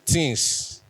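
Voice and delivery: "things" as "tins", said on its own; Benin pronunciation. Shown as a demonstration. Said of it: "we say things".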